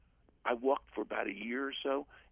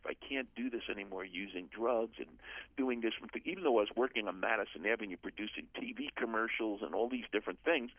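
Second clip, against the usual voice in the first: ordinary talk, nothing marked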